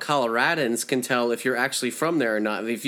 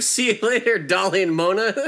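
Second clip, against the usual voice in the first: gloating goodbye; 9 semitones higher, 4 dB louder than usual. Retold or imitated. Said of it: "you're like".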